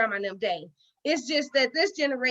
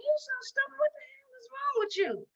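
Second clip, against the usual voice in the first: scolding herself; 11 semitones higher, 8 dB quieter than usual. Retold or imitated. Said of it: "I got to thinking".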